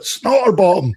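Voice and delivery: high, loud imitated holler; teacher calling out. Teacher singles out former pupil in the crowd, calling out. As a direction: holler the nickname at a pupil you have spotted. Teacher calls out nickname, pointing.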